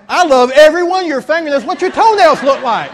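hammed-up delight at the clerk's nails; over-the-top laughing gush; gushing mock praise. Retelling his gushing reply, laughing.

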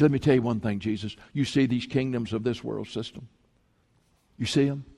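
low, hushed tempter's voice; tempting devil. Acted. Devil offering Jesus the world's kingdoms, low and hushed tempting. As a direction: coax softly and low, like a sly offer.

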